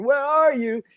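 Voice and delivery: high-pitched imitation of an angry demand; hammed-up high, indignant question; mock angry young man. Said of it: "this angry young man that was going".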